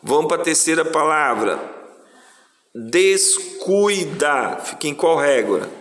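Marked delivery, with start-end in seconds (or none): 2.7-4.6 very slow, drawn-out syllables. Shown as a demonstration. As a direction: stretch each syllable for the class. Teacher dictates word syllable by syllable. (sílaba por sílaba, bem devagar)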